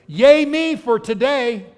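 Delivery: loud, high, drawn-out cheer; mock self-congratulation. Preacher mimics believer boasting to God, mock self-congratulation. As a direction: crow proudly with a laugh breaking in.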